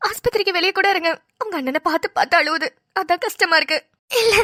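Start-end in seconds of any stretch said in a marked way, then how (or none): none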